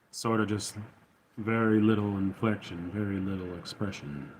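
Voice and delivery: low, flat, almost expressionless; low monotone Thrawn voice. Shown as a demonstration. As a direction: drop down and iron out every bit of melody. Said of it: "sort of that sort of low, sort of monotone".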